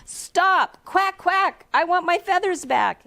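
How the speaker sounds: mock scream of a desperate duckling; screaming duck. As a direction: duck screaming at Grandma to stop. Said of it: "and screamed".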